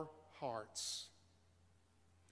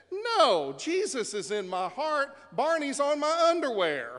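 put-on high, bright child's answer; little girl voice; 7 semitones higher, 5 dB louder than usual. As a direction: voice a proud three-year-old.

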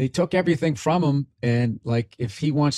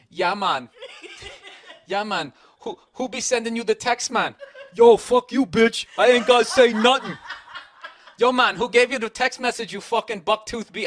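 hammed-up fake Jamaican accent, higher and laughing through it; a joking, over-the-top Jamaican accent, cracking up and getting louder; bad Jamaican accent; 9 semitones higher, 5 dB louder than usual. Mocking a singer in a bad, laughing Jamaican accent. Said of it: "I started doing this really bad Jamaican accent".

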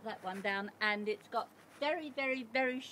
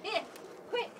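calling the dog; 10 semitones higher than usual. Real, not acted. Calling the pet to come out.

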